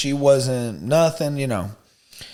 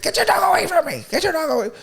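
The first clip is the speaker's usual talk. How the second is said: much higher mock Karen shrieking at a kid; shrill Karen yell; much faster than usual. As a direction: screech it like an entitled lady telling a kid off. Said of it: "So she's like".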